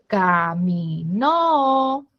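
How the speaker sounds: caminó drawn out with the weight on no; stress on final syllable. Shown as a demonstration. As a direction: hit the last syllable of caminó. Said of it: "es en la sílaba no".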